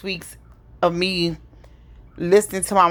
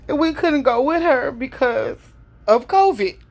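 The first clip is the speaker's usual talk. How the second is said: higher, strained as the voice cracks with grief; voice breaking; 7 semitones higher than usual. Real, not acted. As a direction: hold back tears as the voice breaks.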